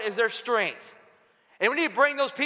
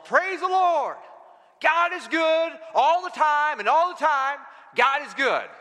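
higher-pitched mock praise shout; imitated joyful yell; 5 semitones higher than usual. Faithful lady praises God from her porch, imitated yelling. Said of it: "just yell out the top of her lungs".

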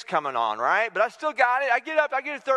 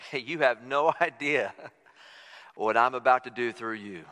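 God speaking; 9 semitones lower than usual. Retold or imitated. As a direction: deliver a low, knowing promise as God.

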